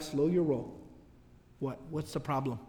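low, quiet menace; quiet and threatening, daring him to answer; low warning. Retold or imitated. Ansar stopping Abdullah at the masjid door, low and menacing.

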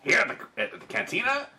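high Deveronian bartender, rising in surprise; puzzled Deveronian accent, climbing high. Pretend. Bartender questions a delivery to the cantina, high Deveronian accent. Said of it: "all Deveronians are gonna talk like this".

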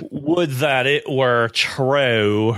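slow, drawn-out cowboy drawl on every word; Hobie's drawl. Retold or imitated. Podcast host imitating Hobie fumbling his line, slow cowboy drawl. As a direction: stretch it out like a Western stunt man. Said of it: "he delivers the line".

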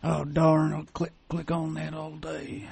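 slow, soft hayseed drawl; hayseed, Sling Blade-like. Pretend. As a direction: drag it out like a backwoods hayseed. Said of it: "little sling bladey at the end".

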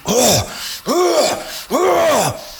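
high raw mock bear roar; imitated demonic roar. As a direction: let the sow's roar rip, high and wild. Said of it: "demonic sounding and so evil sounding".

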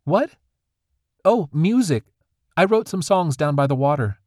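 put-on earnest young girl. Granddaughter tells her grandfather about her songs, earnestly. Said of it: "she answered earnestly".

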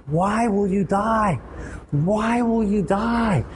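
high, slow, pleading cry; anguished plea. Pretend. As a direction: plead with him not to die. Pleading with the sinner.

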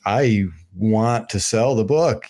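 normal talking voice

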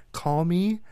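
eighties-movie flirt.

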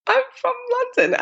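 very high, puzzled reply; mock bafflement. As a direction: answer as if the question makes no sense. Acting out her baffled answer.

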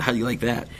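ordinary talk

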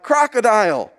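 high mock yell, held back in volume; retold yell. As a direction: imitate a swimmer calling out for help. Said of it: "I hear her yell out".

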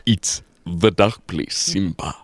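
solemn father lion; deep lion king father. Pretend. Playing Mufasa answering the cub.

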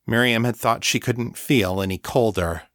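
ordinary delivery

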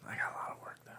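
hushed whispered aside. Real, not acted. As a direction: drop to a whisper.

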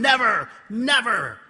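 loud, high, repeated scream. Retold or imitated. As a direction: keep screaming it. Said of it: "And I screamed".

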